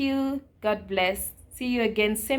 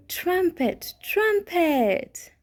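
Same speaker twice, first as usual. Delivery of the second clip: high playful elephant call; elephant trumpet; 8 semitones higher, much slower than usual. Shown as a demonstration. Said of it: "elephant make?".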